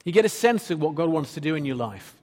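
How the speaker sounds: normal talking voice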